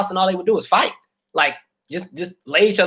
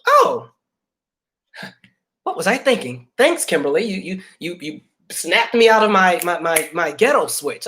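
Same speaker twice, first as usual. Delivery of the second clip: mock gratitude; 2 semitones higher than usual. Sarcastic mock thanks.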